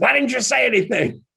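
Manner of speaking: high, loud incredulous complaint; indignant husband. Retold or imitated. Husband blaming his wife after the crash, high and loud. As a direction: blame the wife in disbelief. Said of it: "He said".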